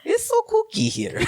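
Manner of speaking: put-on very high, Mario-like accent; botched German accent. [name] remarking on the odd place, high botched German accent. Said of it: "now I'm Mario".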